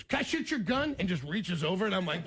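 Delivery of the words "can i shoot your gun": high-pitched, eager mock request; imitating a rowdy singer. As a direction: ask like an excitable wild rocker. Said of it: "And he goes".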